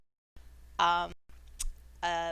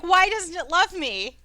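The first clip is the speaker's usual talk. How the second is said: whiny plea; 8 semitones higher, 10 dB louder than usual. Acted. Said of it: "he's like".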